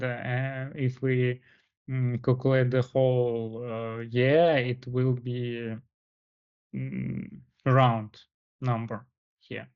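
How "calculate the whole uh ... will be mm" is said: ordinary talk, nothing marked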